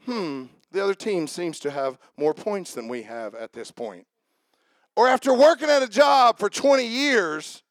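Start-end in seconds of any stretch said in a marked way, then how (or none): 0.0-4.0 low, soft, mock-polite golfer; prim understatement. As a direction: play it dry and overly proper after a terrible shot. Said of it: "look at the screen and say".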